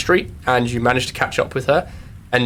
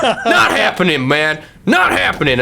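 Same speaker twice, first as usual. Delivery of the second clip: higher, ranting conspiracy trucker; 4 semitones higher than usual. Acted. GM as the trucker, ranting at the rattlesnakes about liberties. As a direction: rant about the government like a riled-up trucker. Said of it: "He's like".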